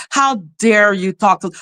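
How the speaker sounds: usual speaking voice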